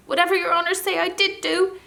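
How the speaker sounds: very high, loud, squeaky pleading little creature; frightened gnome. Acted. Captured gnome begging the prince to let it go, squeaky panic. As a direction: beg for your life in a squeaky panic.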